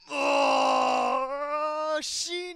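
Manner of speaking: high, loud cry of dismay; anguished shout. Real, not acted. Gamer yelling at a big hit, anguished shout. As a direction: yell out as the hit lands. Losing a fight, crying out.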